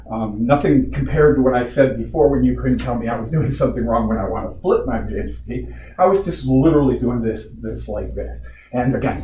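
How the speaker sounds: normal talking voice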